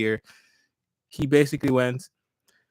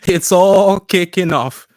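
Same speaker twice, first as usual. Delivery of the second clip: excited hype; 5 semitones higher, 11 dB louder, slower than usual. Real, not acted. Breaking news hype opener.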